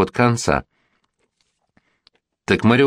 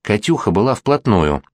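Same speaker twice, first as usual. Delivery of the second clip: usual speaking voice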